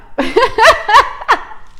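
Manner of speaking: high-pitched burst of laughter. Real, not acted. Laughing out loud remembering mom's shift ring. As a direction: laugh hard at mom's gift.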